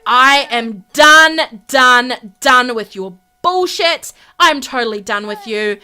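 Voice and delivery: loud, stretched, hammered-out words; fed-up outburst. Acted. Wife finished with her husband, fed up. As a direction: hammer each word out, acting fed up. Wife ends acted quarrel in anger.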